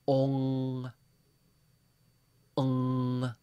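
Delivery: ONG held as one long nasal vowel. Shown as a demonstration. Teacher demonstrates the nasal vowel "ong", held long. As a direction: let "ong" ring through the nose. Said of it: "nasal vowel".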